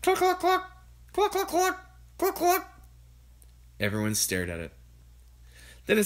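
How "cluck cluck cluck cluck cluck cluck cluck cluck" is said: high, clucking hen sounds. A narrator's reading. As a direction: cluck like a big brown hen.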